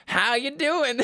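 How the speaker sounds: high, comic drawn-out greeting; drunk father's greeting. Retold or imitated. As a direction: mimic a father barging in cheerfully. Quotes the drunk father walking in.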